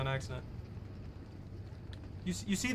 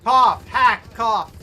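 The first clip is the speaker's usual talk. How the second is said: louder, spoken cough cough; pointed fake cough; 3 semitones higher, 11 dB louder, much slower than usual. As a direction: say the coughs out loud as a hint.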